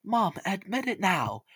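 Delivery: lightly voiced eager son crying out; boy's cry. A narrator's reading. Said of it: "Young Jack cried".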